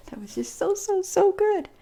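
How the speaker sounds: rising to a high, gushing squeal; delighted squeal. Real, not acted. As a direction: gush over the food, climbing high. Raves about the food.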